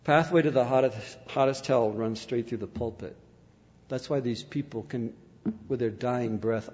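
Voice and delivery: low, hushed pulpit warning; grave preaching. Real, not acted. Preacher warns congregation about hell, low and grave. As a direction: drop soft and low after the climax.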